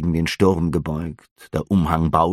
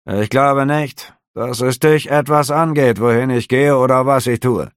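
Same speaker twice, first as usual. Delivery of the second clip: cold officer; 6 semitones higher, 5 dB louder than usual. A narrator's reading. Officer coldly rebuffing the nosy innkeeper. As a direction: put him in his place, coolly.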